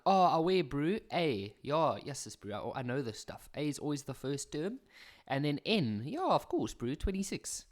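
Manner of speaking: put-on cocky Joburg accent, brew; mock a know-it-all learner in a Joburg accent. Learner brushing off the question, Joburg accent. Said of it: "I always whip out the Josie accent".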